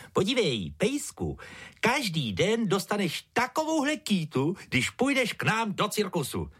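high-pitched ringmaster coaxing a dog; luring. A narrator's reading. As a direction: tempt the dog in a high sing-out voice. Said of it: "lákal ho".

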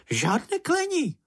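very high little creature reprimand; small creature scolding. A narrator's reading. Čenich warns Mumínek against swearing, high scolding squeak. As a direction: squeak it out as a prim telling-off.

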